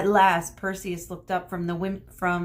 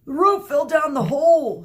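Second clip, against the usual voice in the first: loud, high dopey shout; 9 semitones higher, 10 dB louder than usual. Acted. Theseus shouting back to Medusa, loud dim-witted voice. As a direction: bellow it dimly. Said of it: "shouted Theseus".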